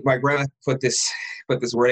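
normal talking voice

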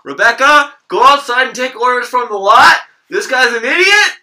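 high, very loud shout to a coworker; clerk yelling out. Acted. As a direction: holler orders across the restaurant. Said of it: "I'd be like".

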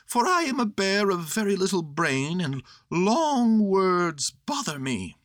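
high-pitched bear of little brain; simple bear, high voice slowing at the end; Pooh, humble. Acted.

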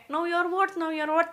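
mild mock yell. Host mimics what people her generation keep shouting, mild mock yell. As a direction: chant it like a crowd's cry, without real volume. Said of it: "mereka selalu teriak kayak".

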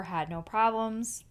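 ordinary delivery

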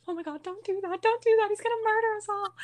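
high-pitched, hammed-up pleading; retold panic. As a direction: reenact begging a friend to stop, voice way up. Retold panic as man chases them.

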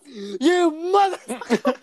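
high, drawn-out outraged mock yell; Mister Cox. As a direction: imitate an old teacher exploding, words stretched slowly. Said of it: "Mister Cox turns around and just went".

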